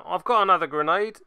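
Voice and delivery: a loud, hammed-up Cockney; cheeky London bloke with a grenade; self-mocking Cockney; bad Cockney. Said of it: "that was awful".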